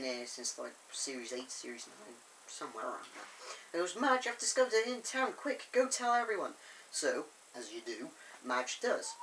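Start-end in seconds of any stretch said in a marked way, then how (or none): 3.9-6.6 higher, hurried mock-urgent news; urgent excitement. Thomas sending Madge to tell everyone, acted urgency. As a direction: rush the news out in a raised pitch.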